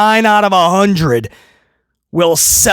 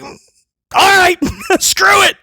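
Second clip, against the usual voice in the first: exasperated giving in; 11 semitones higher, much slower than usual. Retold or imitated. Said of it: "you're just like".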